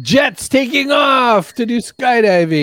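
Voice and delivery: high, loud, drawn-out playful shout; mock announcing shout. Joking that the AC is a jet, mock shout. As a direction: holler it slowly like a joke announcement.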